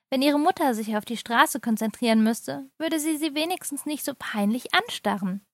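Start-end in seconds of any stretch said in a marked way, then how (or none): none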